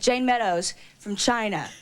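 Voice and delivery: ordinary delivery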